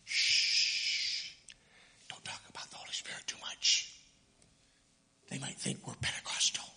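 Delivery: whispered, secretive warning; old church members hushing talk of the Spirit, whispering; hushed whisper. Retold or imitated. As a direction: hush them like it's forbidden. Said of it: "a church that didn't talk about that".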